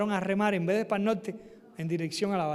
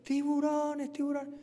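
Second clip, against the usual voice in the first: imitated calling voices; 7 semitones higher, slower than usual. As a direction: imitate someone calling from afar. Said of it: "se oían muy claras las voces".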